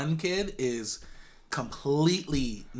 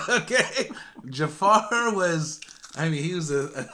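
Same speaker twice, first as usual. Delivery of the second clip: laughing; 5 semitones higher, slower than usual. Real, not acted. Laughing about scary Jafar.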